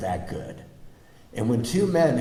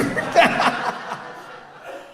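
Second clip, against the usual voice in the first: high breathless giggle; real delighted laughter; high airy laugh. Real, not acted. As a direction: burst into a high silent laugh. Laughing after being hired.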